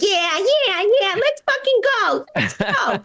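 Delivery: high, eager hype-man yelp; hyped-up dog voice. Retold or imitated.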